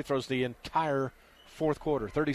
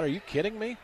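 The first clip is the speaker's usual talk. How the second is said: incredulous; 6 semitones higher than usual. Real, not acted. Disbelief at referee's foul call.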